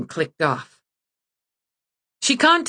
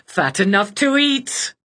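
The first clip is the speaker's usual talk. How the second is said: gloating witch; 5 semitones higher than usual. Acted. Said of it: "She laughed".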